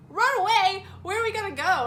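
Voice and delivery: loud, high, disbelieving retort; incredulous. Retold or imitated. Girl answering boyfriend's runaway plan, loudly incredulous. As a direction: throw his idea back at him in acted disbelief.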